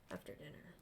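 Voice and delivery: ordinary talk, nothing marked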